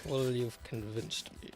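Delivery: low, soft echo of a put-on voice; mock Cumberbatch echo. Friend echoing Cumberbatch's line, low mock voice. Repeating the line after the impression.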